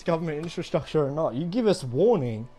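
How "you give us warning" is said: ordinary talk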